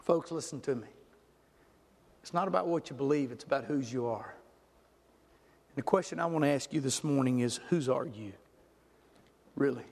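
grave, intimate sermon delivery; hushed solemn preaching. Acted. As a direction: bring it right down and let each phrase sink in. Drops to solemn appeal.

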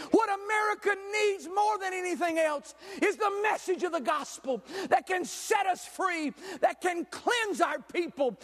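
forceful sermon with stretched key words; impassioned preaching. Acted. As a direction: drive each phrase home to the congregation.

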